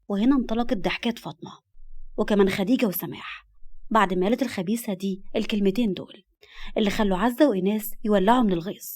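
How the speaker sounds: plain reading voice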